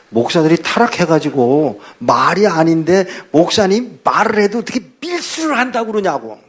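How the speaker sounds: scolding the pastor in rising outrage; angry deacon. Retold or imitated. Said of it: "화를 내더라고요". (화난 집사의 꾸짖음)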